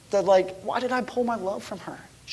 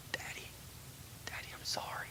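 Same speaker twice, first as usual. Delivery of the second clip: put-on barely audible whisper of a child saying sorry; whispered child's apology; 24 dB quieter than usual. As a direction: hush it almost to nothing. Daughter's apology re-enacted in sermon.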